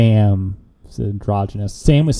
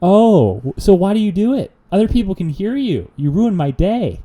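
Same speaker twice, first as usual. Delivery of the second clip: exasperated; 9 semitones higher, 3 dB louder than usual. Retold or imitated. Exasperated rant, pitched up.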